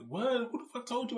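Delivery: mother's voice jumps up in alarm; shrill-free but high alarmed what; shocked and high. Retold or imitated. Mom's shocked reply voiced.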